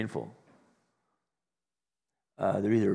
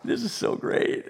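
breathy laugh through the words; much higher, laughing whisper; 11 semitones higher, slower than usual. Real, not acted. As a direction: laugh it out, mostly air.

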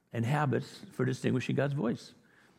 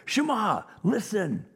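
high, loud, commanding proclamation; big emphatic voice; 9 semitones higher, 4 dB louder, slower than usual. Shown as a demonstration. Preacher showing the Shema's call to Israel, big and emphatic. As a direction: proclaim it big, pitched well up. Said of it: "The Shema is a big voice".

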